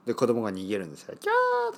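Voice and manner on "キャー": very high squealing scream; kids' scream. Retold or imitated. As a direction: shriek like a fleeing child. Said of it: "とか言いながら".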